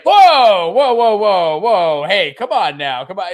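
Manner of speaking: high, loud whoa that fades into a shrug; hammed-up "calm down" whoas; mock dismissive whoa. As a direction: wave off the good priest like he's overreacting. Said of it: "everyone's just like".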